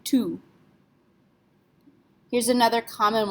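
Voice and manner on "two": two, like "too", silent T; French tout. Shown as a demonstration. Teacher showing tout with silent T. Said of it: "we just pronounce this two".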